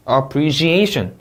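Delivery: "appreciation" slow, stress clearly marked; careful word stress. Shown as a demonstration. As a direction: lean on the A before -tion in "appreciation". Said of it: "강세 잘못 넣으면 없는 단어가". ("appreciation" 강세 살려 천천히)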